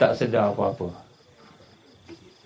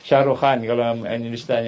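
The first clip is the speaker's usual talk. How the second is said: ordinary delivery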